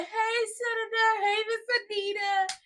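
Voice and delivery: high, drawn-out singsong hello; mock greeting. [name] greeting the women as he walks over, mock singsong.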